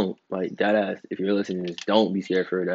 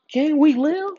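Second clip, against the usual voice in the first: squeaky high plea; 3 dB louder, slower than usual. Acted.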